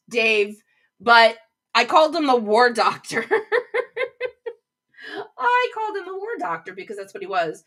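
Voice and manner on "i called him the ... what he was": giggling through the words; real laughter breaking the line; laughing. Real, not acted. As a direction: laugh helplessly while speaking. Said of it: "know exactly why I'm laughing".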